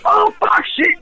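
high shouted delight, fan gushing; excited fan reaction. Retold or imitated. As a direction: squeal it out like a thrilled fan. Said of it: "Be like".

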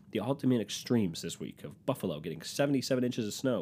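ordinary delivery

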